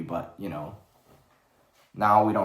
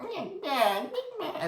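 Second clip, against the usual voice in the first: put-on squeaky baby-creature babble, very high; Grogu babbling; 5 dB quieter, slower than usual. Vlogger mocks Grogu's babbling while piloting, squeaky. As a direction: mimic Grogu's whiny babble. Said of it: "Grogu's being like".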